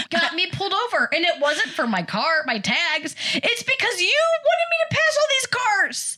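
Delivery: high, exasperated scolding; high exasperated rebuke to mom. Retold or imitated. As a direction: scold her in disbelief. Scolding mom after being stopped.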